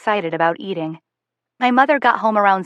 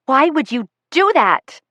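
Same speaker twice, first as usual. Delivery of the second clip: higher, slow angry yell; 6 semitones higher, 3 dB louder, slower than usual. A narrator's reading. Daughter yelling at her mother after leaving Will's. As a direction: hurl it at her, hurt and angry. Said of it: "I yell".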